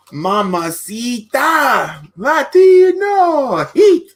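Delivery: loud, stretched wrestling catchphrase; Eddie Guerrero catchphrase. Retold or imitated. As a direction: draw each word out like a ring entrance.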